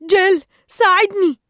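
high, loud cry for help; shouted plea. A narrator's reading. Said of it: "صاح".

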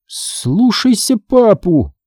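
high, slow undead scolding; scolding zombie mother. A narrator's reading. Mother orders son to obey, high slow zombie drawl.

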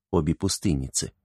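usual speaking voice

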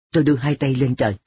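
ordinary delivery, nothing marked

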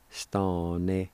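"stane" drawn out slowly as the second part; broken-down chunk. Shown as a demonstration. Teacher breaking a long word down, slow chunk. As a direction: stretch stane into separate syllables. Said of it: "break those two words down".

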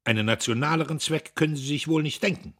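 smirking scorn; contemptuous smirk. A narrator's reading. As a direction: rattle it off with smug disdain. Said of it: "Dietrich feixte verachtungsvoll".

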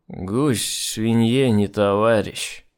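put-on slow, dragging drunk proverb. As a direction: say it blind drunk, slowly. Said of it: "в хлам опьяневший журналюга".

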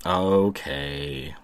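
deep, soft suggestive okay; low flirty tone. Real, not acted. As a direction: drop the voice, a little seductive. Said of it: "love it when you ... use that tone of voice with me".

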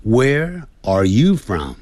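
word by word, lifting YOU. Shown as a demonstration. Teacher demonstrates where the voice rises, stress on YOU. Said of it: "声音要提高".

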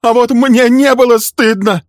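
very high, loud anguished shout; embittered woman's outburst; bitter cry. A narrator's reading. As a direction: scream back bitterly. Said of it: "горько вскричала Милли".

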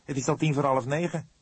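brusque. A narrator's reading. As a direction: push the point impatiently. Vledder pushes on rudely. (Vledder drijft ongeduldig aan, bot)

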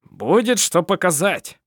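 loud, high, drawn-out satisfaction; chuckling. A narrator's reading. Sevet proud of his new figurine, amused gloating. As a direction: gloat merrily, stretching the words. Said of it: "посмеивался он".

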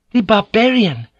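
high, loud shocked gasp. Acted. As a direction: blurt it out aghast. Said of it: "Kiku gasped".

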